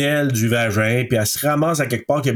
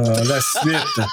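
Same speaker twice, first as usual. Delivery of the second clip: laughing; much slower than usual. Real, not acted. Laughing at joke.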